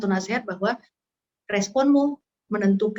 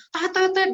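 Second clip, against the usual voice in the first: high honking tet tet tet; playful mock car horn; horn honks; 9 semitones higher than usual. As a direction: beep like a car horn in traffic. Imitating car horns.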